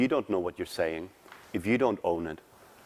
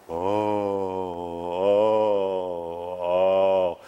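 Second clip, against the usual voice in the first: drawn-out musical oh's, a little louder; nurse wailing; 5 dB louder, much slower than usual. Retold or imitated. Coach voicing the nurse's lament as drawn-out music. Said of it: "then you get the nurse going".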